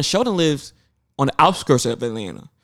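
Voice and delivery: normal talking voice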